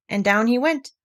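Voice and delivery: ordinary delivery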